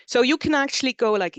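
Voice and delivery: normal talking voice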